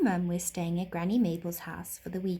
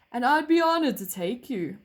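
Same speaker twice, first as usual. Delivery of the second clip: horse voice; 4 semitones higher, 6 dB louder than usual. A narrator's reading. Horse character voice.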